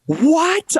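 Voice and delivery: high-pitched yelp of disbelief; astonished. Retold or imitated. Seeing the match graphic on screen, high astonished cry. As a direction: imitate your stunned reaction, voice shooting up. Retelling his shock at the graphic.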